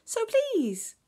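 high, loud wheedling please; grinning shark plea. A narrator's reading. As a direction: beg it with a grin, high and loud. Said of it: "grinned the shark in the dark".

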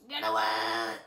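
a big bad bird warning you off; mock monster bird threat; deep bird voice. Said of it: "He's like".

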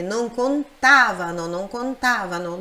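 "contavano" with a strong TA, then repeated; stress on TA. Shown as a demonstration. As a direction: model the correct conTAvano. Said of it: "Pronúncia forte nesse tá".

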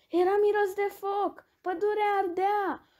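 high, urgent storyteller's cry; alarmed narration. A narrator's reading. Forest fire in the story. (povestire alarmată, cu voce înaltă)

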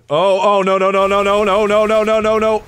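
high rapid string of panicked protest. Real, not acted. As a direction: rattle it out in rising alarm. Panics as he gets killed in game.

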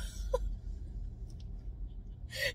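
a high, breathy laugh with no voice; wheezing laugh. Real, not acted. Recalling laughing at her brother's fall, wheezing.

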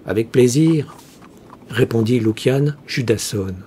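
plain reading voice

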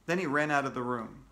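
ordinary delivery, nothing marked

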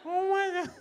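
hammed-up shock that winter came back; mock panic. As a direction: play a stunned Canadian baffled by snow. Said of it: "you guys are like".

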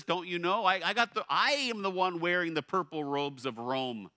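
loud, high, proud Roman governor demanding an answer; Pilate. Acted. Preacher voicing Pilate to silent Jesus, loud and proud. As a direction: bellow it like an offended ruler.

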